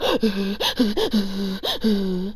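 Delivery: a sharp, soft shocked gasp; imitated gasp. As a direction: gasp like you just got bad news. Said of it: "like that".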